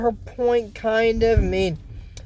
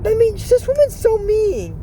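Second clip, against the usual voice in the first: high outraged; 11 semitones higher than usual. Real, not acted. Outraged comment on the witch.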